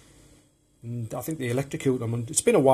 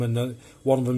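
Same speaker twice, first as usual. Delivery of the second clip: ordinary talk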